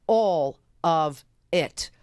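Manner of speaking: low, slow, each word set apart; deliberate, weighty spacing; word-by-word emphasis. Real, not acted. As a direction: land every word separately.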